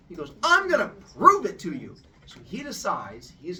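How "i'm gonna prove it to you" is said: much higher and louder vow; defiant vow. Acted. Boy swearing to the bullies he'll prove it, loud. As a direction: vow it fiercely.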